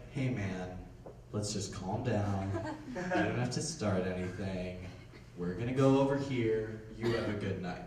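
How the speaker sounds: quiet, calming voice of reason; soft and calm, defusing the guy; calm, soothing. Retold or imitated. Being a responsible person.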